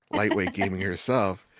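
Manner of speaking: ordinary delivery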